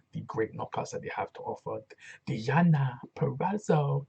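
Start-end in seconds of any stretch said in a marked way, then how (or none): 2.3-4.1 slow, loud, drawn-out name call; imitating her intro. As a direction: stretch the name out slowly. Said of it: "the way she said that was funny".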